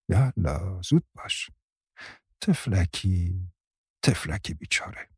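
put-on old family friend, complaining then soothing; Bibi jun, fussing and consoling. A narrator's reading. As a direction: grumble a little, then soften into pitying comfort.

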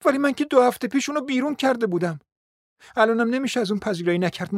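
high, agitated, hurried complaint; dismayed despair. A narrator's reading. Mother fretting to Zina over the visitor, high-pitched dismay. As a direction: fret in a high, rushed voice. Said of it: "بعد با یأس و حرمان افزود".

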